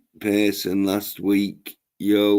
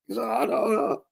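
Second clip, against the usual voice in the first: mock crying; 13 semitones higher, 3 dB quieter than usual. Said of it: "pretending to nearly cry".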